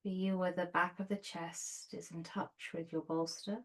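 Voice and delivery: soft, unhurried meditation voice with long pauses; guided meditation. Real, not acted. Yoga teacher settling students onto their bolsters, soft guided meditation.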